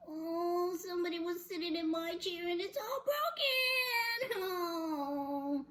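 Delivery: high, drawn-out mock wail. Baby Bear with his broken chair, mock wailing.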